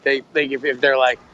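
usual speaking voice

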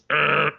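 short imitated buzzer; harsh vocal buzz; buzzer sound. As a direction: make the noise of a game-show buzzer. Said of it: "And you hear this".

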